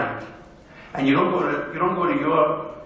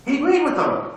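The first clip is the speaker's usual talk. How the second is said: high-pitched, incredulous repeat; disbelief; 9 semitones higher, 3 dB louder than usual. Real, not acted. Speaker tells how a father backed his children, in disbelief. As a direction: repeat it higher, as if unable to believe it.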